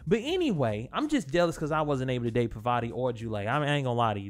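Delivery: ordinary delivery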